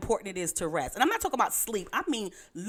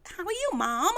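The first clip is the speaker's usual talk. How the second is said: high, mock-chirpy greeting; exaggerated high mimicry of a cheery hello; son mimicking Mom; 10 semitones higher than usual. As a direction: tease Mom by parroting her happy voice. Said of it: "that's how you sound".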